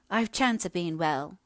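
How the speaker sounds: slow, querulous complaint; mildly peevish village wife. A narrator's reading. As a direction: grumble like a neglected invalid. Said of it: "said she querulously".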